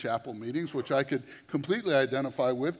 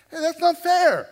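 much higher, much louder whiny joke complaint; mock protest; 17 dB louder than usual. Preacher joking he was robbed of the altar call, high mock whine. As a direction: whine like a cheated kid, laughing.

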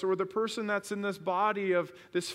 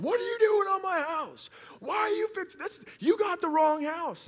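high, loud shout of an irate homeowner; yelling; 14 semitones higher, 5 dB louder than usual. Retold or imitated. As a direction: yell up at strangers on your roof, acted out. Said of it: "starts yelling at him".

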